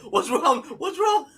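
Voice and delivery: imitating a frightened, high-pitched questioner; scared. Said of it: "she did get scared".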